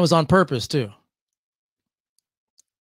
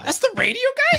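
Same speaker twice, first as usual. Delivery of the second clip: high, shouted mock amazement at the radio guy; mock amazed fan. Guest spotting him at a party, high shouted surprise. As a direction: squeal it like a starstruck partygoer. Mocking fans recognizing him.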